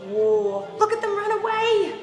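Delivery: plain reading voice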